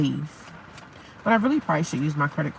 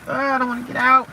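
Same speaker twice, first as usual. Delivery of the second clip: whiny reluctance; 6 semitones higher than usual. Retold or imitated. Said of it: "and I'm like".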